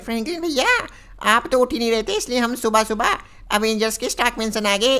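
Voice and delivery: high, childlike cartoon boy voice; cartoon kid greeting his big brother, high and childlike; Shinchan kid voice. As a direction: play the little cartoon boy, chirpy and high. Shinchan voice in game video.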